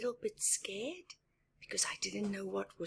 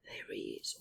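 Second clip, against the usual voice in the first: much lower and softer, breathy reveal; ominous hush; 12 semitones lower, 8 dB quieter than usual. Pretend. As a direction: hush the kids as the lion appears. Revealing scary lion.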